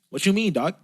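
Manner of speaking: high, loud mock Canadian Jamaican accent. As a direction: imitate an indignant Canadian Jamaican comeback. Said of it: "and a Canadian Jamaican".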